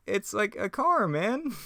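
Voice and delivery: slow, very high cartoon slacker voice; Shaggy. Shaggy pointing out a car, high drawn-out impression. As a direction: stretch it out high and squeaky. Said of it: "I really got Shaggy down".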